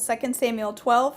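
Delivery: plain reading voice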